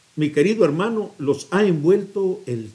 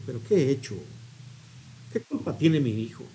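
softer, regretful self-reproach; remorseful father; 5 semitones lower, 10 dB quieter, faster than usual. Acted. Father softly reproaches himself after punishing his son. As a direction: murmur it to yourself in regret.

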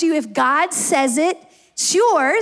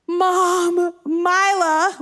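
put-on higher, drawn-out wail of an upset kid; mock crying child; 5 semitones higher, much slower than usual. Dad imitating his crying son coming into the house, mock tearful. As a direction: imitate a tearful, whining little boy. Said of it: "he is crying, and he says".

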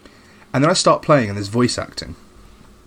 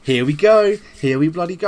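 excited; 6 semitones higher than usual. Retold or imitated. Hyped as the remaster starts.

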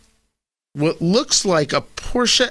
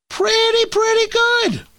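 high, sing-song mock catchphrase; mock pretty good. Host echoing his own verdict in a high mock voice. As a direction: toss off the catchphrase in a squeaky put-on voice. Echoes own line in put-on voice.

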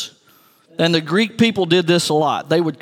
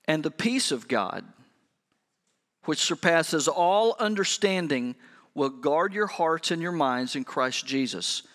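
scripture reading; 3 semitones lower, 8 dB quieter than usual. Acted. Reading scripture slowly, softly.

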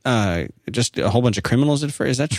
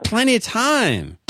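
drawn-out, much higher reassurance, slow; 11 semitones higher, much slower than usual. Real, not acted. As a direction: stretch it out high and upbeat. Cheering up a 25-year-old caller.

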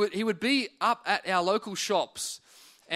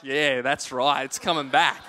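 slow smug gloat; 5 semitones lower, 4 dB louder, slower than usual. Retold or imitated. Gloating at laughing friends.

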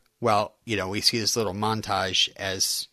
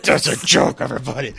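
louder announcement; 5 dB louder than usual. Pretend. Co-host redoing the disclaimer after being teased, louder and playful. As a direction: announce it openly to the whole audience.

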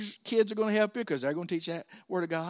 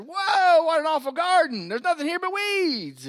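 surprised; 10 semitones higher, 6 dB louder than usual. Retold or imitated. Friend sees the awful garden.

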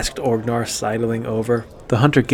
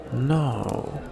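soft breathy no; dismayed hunter under his breath; breathed disbelief; 8 dB quieter than usual. A narrator's reading. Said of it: "He breathed in disbelief".

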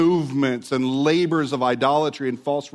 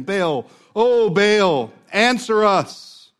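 slow, loud, higher pleading call; crying out to Baal; 6 semitones higher, 6 dB louder, much slower than usual. Retold or imitated. Prophets calling on Baal, slow loud plea. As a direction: drag out the plea to a silent god. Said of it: "called upon the name of Baal".

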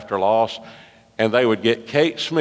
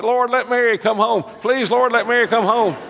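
a comic, pitched-up plea for help; pleading prayer; 13 semitones higher than usual. Retold or imitated. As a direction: beg the Lord to send her home. Desperate prayer for wife's return.

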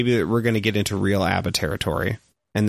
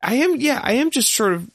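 high mock voice. Mock-earnest answer.

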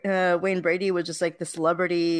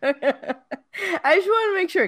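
laughing through the words; 10 semitones higher, 4 dB louder, slower than usual. Real, not acted. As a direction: giggle while saying it. Laughing while checking recording.

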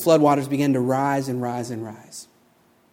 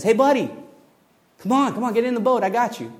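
higher, louder rescuer calling from a boat; friendly call out; 8 semitones higher, 4 dB louder, faster than usual. Acted. Boat rescuer calls to stranded man, high and loud. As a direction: hail him over the water, come on, get in.

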